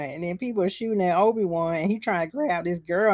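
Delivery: ordinary delivery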